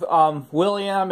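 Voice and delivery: ordinary talk, nothing marked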